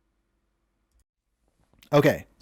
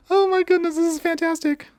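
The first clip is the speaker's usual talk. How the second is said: high, laughing delight; delighted squeal. Real, not acted. Player watching the combo win, squealing with delight. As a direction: squeal with joy as the combo fires.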